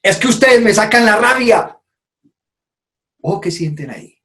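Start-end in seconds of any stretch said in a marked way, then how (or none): 0.0-1.8 high, accusing outburst; blaming anger. Shown as a demonstration. Speaker demos blaming the audience, loud accusing tone. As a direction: throw the blame at the audience. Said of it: "Escuchen esto".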